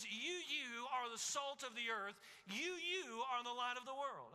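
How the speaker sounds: repeated "You, you" hit hard and held, slow; emphatic you. Acted. Preacher quoting Jesus to the church, "You" stressed emphatically. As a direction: lean hard on each "You, you" before the line. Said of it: "he poses it in the emphatic form".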